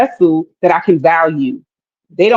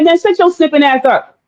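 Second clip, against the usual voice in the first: higher-pitched imitated put-down; mock dismissive comeback; 8 semitones higher than usual. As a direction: shut him down in a raised pitch, imitating. Acts men's hostile comeback.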